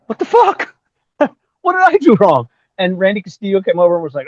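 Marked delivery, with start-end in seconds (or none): 0.1-2.5 very high-pitched, wailing disbelief; bewildered protest. Retold or imitated. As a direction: plead innocence, voice shooting way up. Acts bewildered protest after the fight.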